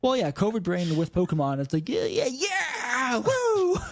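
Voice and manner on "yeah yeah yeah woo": mock excited cheer. Mocking covid-brain excitement.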